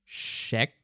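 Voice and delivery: ordinary talk, nothing marked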